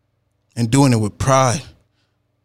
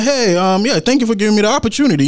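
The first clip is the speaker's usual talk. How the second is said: bright, fast, higher thank-you; eager thanks; 9 semitones higher, faster than usual. Retold or imitated. Thanking the husband for the chance, eager and fast. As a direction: rush out the thanks to the client.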